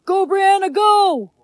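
high excited cheer. Acted. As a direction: egg her on like a fan. Cheering her on to eat.

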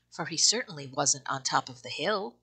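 plain reading voice

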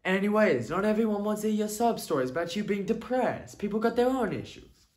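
high, dismissive New York voice; New York accent. Acted. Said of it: "speaking like you're from New York".

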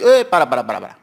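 sudden loud cry to cut the singer off; interrupting shout. Retold or imitated. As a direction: shout them down to stop the song. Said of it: "Todos lo interrumpen".

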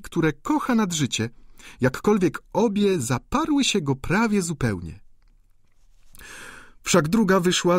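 amused viscountess. A narrator's reading. Viscountess laughingly explains Eugene to the duchess, teasing. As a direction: tease the duchess about him.

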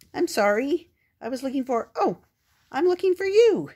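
high, flustered little pup apologizing; flustered puppy voice. Acted. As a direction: stammer out a startled apology. Spot bumps into reindeer.

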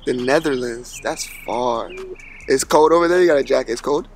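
ordinary delivery

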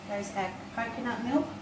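normal talking voice

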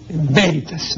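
"Veritas" as BÉ-ri-tas, a Spanish B with stress on the first syllable; traditional Spanish veritas. Shown as a demonstration. Teacher demonstrating the traditional reading to a radio listener. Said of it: "una tilde en B".

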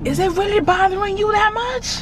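high hammed-up ratchet protest; put-on ratchet. Prankster challenging her annoyed fiance, high mock ratchet. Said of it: "I will be acting ratchet".

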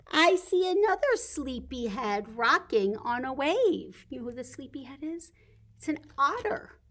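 lively storybook read-aloud, slowing at the ends; bright read-aloud for toddlers; reading to children. Acted. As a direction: read the picture book to the little ones. Reads picture book to children.

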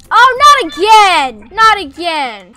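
loud, drawn-out groan of a cry; exasperated shout. Real, not acted. Cashier seeing robbers again, slow loud exasperated shout. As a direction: yell it like this keeps happening.

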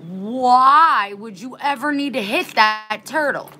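high, loud, outraged demand; angry. Real, not acted. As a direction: cry out in exasperated disbelief. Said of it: "It makes me so angry".

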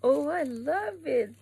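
high, slow squeal of delight; delighted gush. Real, not acted. Vlogger unboxing angel bag, high thrilled squeal. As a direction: savour it, thrilled. Unboxing, gushing over an item.

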